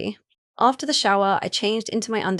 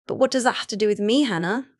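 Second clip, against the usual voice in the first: ordinary delivery, nothing marked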